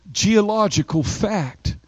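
usual speaking voice

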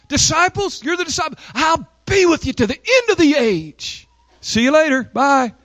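high-pitched acted goodbye from above; mock farewell. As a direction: call a high parting promise, then sign off. Mock farewell of ascending Jesus.

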